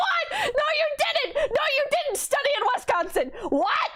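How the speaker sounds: hammed-up outraged disbelief; shouted disbelief. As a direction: yell it in laughing disbelief.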